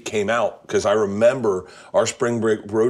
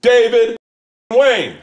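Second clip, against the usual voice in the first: very high, much louder yell; shouting a name; 6 dB louder, slower than usual. Retold or imitated. Drunk at a party, yelling at a stranger, full shout. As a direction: yell it as loud as you can. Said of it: "As loud as I can".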